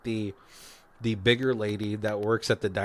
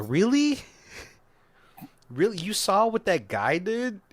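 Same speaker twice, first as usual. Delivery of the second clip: incredulous; 9 semitones higher than usual. Acted. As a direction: question it in mock outrage. Teller acts out disbelief at character.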